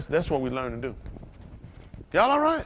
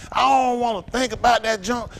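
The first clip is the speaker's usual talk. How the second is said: put-on old drunk self; 8 semitones higher, 4 dB louder than usual. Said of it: "I used to be like that".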